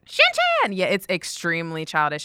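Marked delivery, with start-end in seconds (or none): 0.1-0.7 very high, excited exclamation; excited squeal. Real, not acted. Anime fan names her favourite childish show, excited squeal. Fan calls out a show's name.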